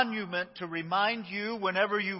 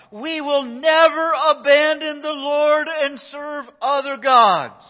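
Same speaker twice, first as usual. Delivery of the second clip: loud, slow, raised-pitch vow; solemn vow; 9 semitones higher, 12 dB louder, slower than usual. Retold or imitated. The people swear to Joshua, a loud, slow vow. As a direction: proclaim it to the whole crowd, slow and loud.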